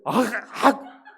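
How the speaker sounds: loud mock shouts; short, forceful yells of a tough girl; brash, tough girl. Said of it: "오히려 힘세고 막 드세고 막". (드센 여자처럼 크게 내지르기)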